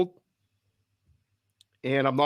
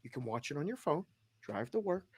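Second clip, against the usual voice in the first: calm reassurance; 4 semitones higher, 13 dB quieter than usual. Retold or imitated. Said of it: "And I'm like".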